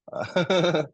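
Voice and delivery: high loud laugh; burst of laughter. Real, not acted. Laughs at chat joke.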